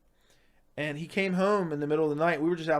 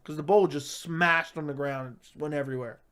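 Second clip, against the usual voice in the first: usual speaking voice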